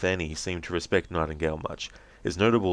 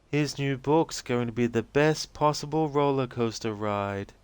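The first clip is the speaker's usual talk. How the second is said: put-on high, slow, dreamy young woman's voice; spacey and childlike, drifting; dazed Rose; 7 semitones higher, slower than usual. Rose answering the agent's questions, dazed and high-voiced.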